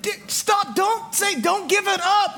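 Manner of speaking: very high, hushed-down urgent plea; alarmed kid brother. Retold or imitated. Little brother begging older one to stop, mock-urgent. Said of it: "I was like".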